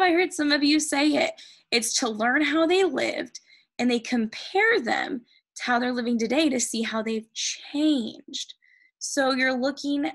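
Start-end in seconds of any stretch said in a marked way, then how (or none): none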